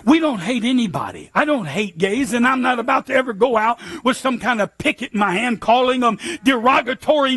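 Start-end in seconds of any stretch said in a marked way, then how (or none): none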